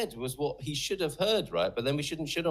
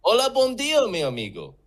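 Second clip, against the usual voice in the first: high, loud Portuguese hello falling away; showy burst of learner's Portuguese, starting high; mock tuned-up greeting; 8 semitones higher, 7 dB louder than usual. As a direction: launch into a hammed-up Portuguese greeting. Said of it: "Okay, I'm ready now".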